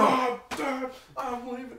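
high-pitched mock cry of pain; acted pained outburst. Injured wrestler quitting on the doctor, acted outburst. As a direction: play a hurt wrestler storming off.

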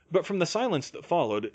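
ordinary delivery, nothing marked

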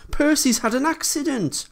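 very high, alarmed announcement; breaking bad news in a high voice; hurried news. A narrator's reading. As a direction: burst in with urgent news, voice pitched right up. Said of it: "Toby hurried in saying".

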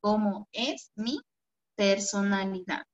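unhurried dictation, word by word; slow dictation. Shown as a demonstration. Teacher repeating the homework question for pupils, slowly. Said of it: "va con tilde en la primera O".

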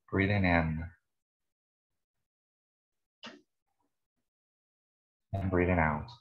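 calm meditation guide, long pauses between phrases; guided meditation. Real, not acted. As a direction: lead listeners unhurriedly through breath and body.

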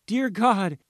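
bewildered shout. A narrator's reading. Mother hearing her child whisper, bewildered shout. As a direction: cry out in shock. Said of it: "the mother shouted, bewildered".

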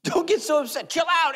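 high, loud comic protest; mock indignant shout. Aaron telling Moses to calm down, mock indignant shout. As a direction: shout it like an exasperated excuse-maker. Aaron quote acted loudly.

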